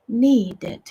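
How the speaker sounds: normal talking voice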